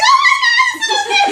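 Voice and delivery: very high squeal of delight. Retold or imitated. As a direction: gush like she's thrilled to bits. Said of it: "She's like".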